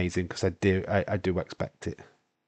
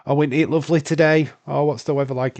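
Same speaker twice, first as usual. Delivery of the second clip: sarcastic; 6 semitones higher, 6 dB louder than usual. Retold or imitated. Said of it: "It's sarcasm".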